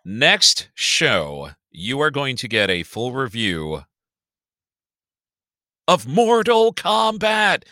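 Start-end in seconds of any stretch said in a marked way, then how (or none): 5.9-7.6 slow, higher, louder reveal of the title; hyped-up title reveal; announcer shout. Acted. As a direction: boom it out like a fight announcer.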